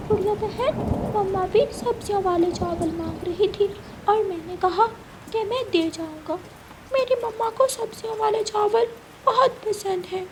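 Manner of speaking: put-on a small boy insisting on his promise; eager little boy. Kuku tells sister Gudiya his mama wants rice, high childlike voice.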